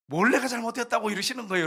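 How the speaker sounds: imitating an aggrieved believer, pitched high; high-pitched mock grumbling at God; resentful complaint. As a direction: protest to God as if wronged. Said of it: "또 원망하네".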